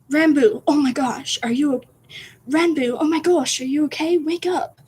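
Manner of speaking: high-pitched attempt at a British accent; Tubbo, urgent. Tubbo shakes Ranboo awake, urgent calling. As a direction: urgently rouse someone in a high put-on voice. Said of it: "I can't make Tubbo voice".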